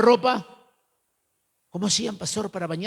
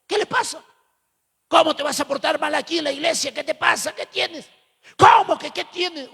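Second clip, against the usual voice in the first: mock angry confrontation; 6 semitones higher than usual. Leader and youth quarrel in church.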